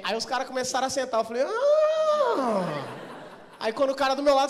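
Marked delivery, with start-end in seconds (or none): 1.4-2.8 long high-pitched exaggerated "ah"; gemido exagerado. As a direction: let out a high, drawn-out comic wail. Reação aos passageiros sentando. (gemido agudo e exagerado)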